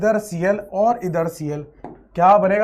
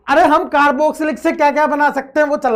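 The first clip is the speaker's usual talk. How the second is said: louder, higher burst of exasperated explaining; excited shouting; 6 semitones higher, 5 dB louder than usual. Real, not acted. Teacher reminds the class what the current topic is, excited shouting. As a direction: raise your voice in an excited outburst. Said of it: "तो sir चिल्ला क्यों रहे हो".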